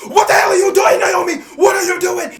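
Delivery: high, loud angry shout; imitating a livid boss yelling; furious yell. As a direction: scream at her like a furious boss. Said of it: "damn near blowing out a damn blood vessel".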